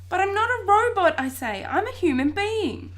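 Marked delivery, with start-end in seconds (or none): 0.1-1.2 loud, high-pitched insistence; emphatic protest. A narrator's reading. Boy arguing with a talking vacuum cleaner, emphatic protest. 1.6-2.9 loud, high, drawn-out insistence; emphatic protest. A narrator's reading. Boy insisting to vacuum cleaner he is human, emphatic protest.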